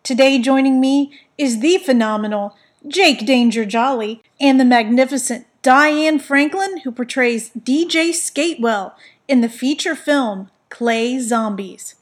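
loud, punchy show host; announcer voice. Real, not acted. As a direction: boom it out like a host opening the show. Hosting the show's opening.

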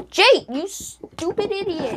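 high, slow mock insult; heated family shouting. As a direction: scold him like an annoyed sibling. Sibling squabble, name calling.

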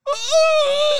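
loud, very high imitated wail; mock crying wail. Imitating Brandon after the punch, loud crying wail. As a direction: burst into a hammed-up cry. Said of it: "and he starts crying".

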